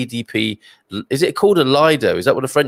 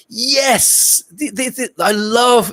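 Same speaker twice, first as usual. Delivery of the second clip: high, triumphant burst; delighted yes; 11 semitones higher, much slower than usual. Real, not acted. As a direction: cheer it like you've found treasure. Delighted by a viewer's fact.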